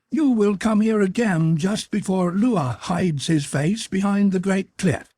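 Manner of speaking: high, strange creature voice, announcing. A narrator's reading. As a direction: proclaim it like an eerie winged being. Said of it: "announced the creature".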